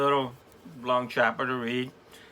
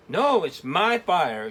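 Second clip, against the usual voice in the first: slow, higher insistent shout; 6 semitones higher, 4 dB louder, slower than usual. Acted. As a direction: plant your feet and claim it. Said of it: "Trevor shouted".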